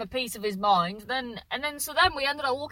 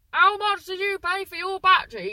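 much higher and louder, outraged; loud indignant demand; 10 semitones higher, 9 dB louder than usual. Retold or imitated. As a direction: fire the question down the phone. Fiance demands, on phone to brother.

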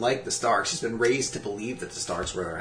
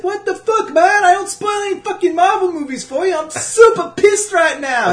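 high, loud mock rant; shrill-free falsetto fury, hammed up; angry French friend; 11 dB louder than usual. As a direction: squeal it like an outraged friend. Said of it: "So he was like".

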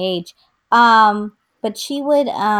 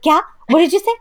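louder, sharply higher challenge; imitated parent's raised-voice challenge; parent calling back; 10 semitones higher, 6 dB louder, much faster than usual. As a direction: demand it as an overhearing parent, pitch climbing on the repeat. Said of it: "they would, like, walk back in".

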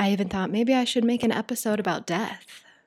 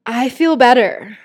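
happy relief, louder; brighter, lifted voice; 3 semitones higher, 7 dB louder than usual. Real, not acted. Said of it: "maybe you hear it in my voice".

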